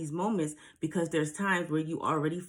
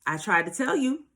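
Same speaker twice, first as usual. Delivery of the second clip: loud, higher, mock-scolding told-you-so; universe cutting in; 3 semitones higher, 6 dB louder than usual. Universe halting someone who ignored the signs, mock scolding. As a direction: imitate a stern universe calling a halt. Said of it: "universe has to come in and be like".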